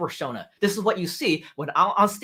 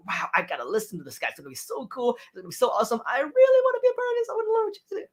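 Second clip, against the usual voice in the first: mock eager fan, climbing much higher and faster; excited audience member; 7 semitones higher, faster than usual. An excited listener hooked by the intro, gushing much higher. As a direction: gush like a thrilled newcomer. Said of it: "people will just be like".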